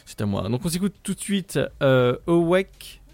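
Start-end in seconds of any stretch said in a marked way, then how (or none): 2.3-3.0 English title pronunciation. Shown as a demonstration. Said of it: "Mon, mon accent".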